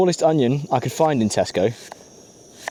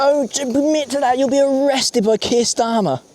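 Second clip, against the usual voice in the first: mock high warning; 13 semitones higher, slower than usual. Joking warning about garlic theft.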